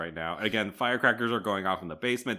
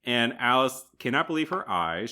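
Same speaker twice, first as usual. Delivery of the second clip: ordinary delivery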